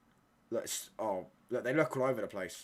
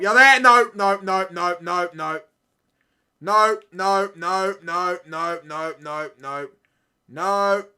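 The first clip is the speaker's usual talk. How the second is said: loud, higher frantic yells of no; panicked shouting; 6 semitones higher, 14 dB louder, slower than usual. Real, not acted. Fan watching his team defend yelling in panic. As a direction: scream no over and over at the screen.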